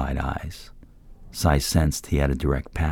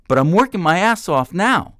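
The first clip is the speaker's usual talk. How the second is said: very high, loud desperate yell; 8 dB louder than usual. A narrator's reading. Man yelling at the mentor, desperate. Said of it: "Troy yelled".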